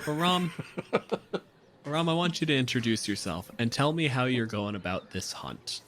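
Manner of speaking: ordinary talk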